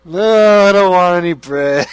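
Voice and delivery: husband sulking at his date; miserable refusal. Retold or imitated. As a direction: refuse it grumpily. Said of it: "he's just like".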